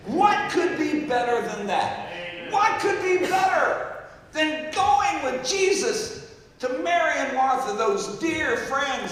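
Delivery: loud, slow, soaring sermon build; booming revival preaching; full-force preaching. Acted. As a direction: preach it big and drawn out. Preaching climax.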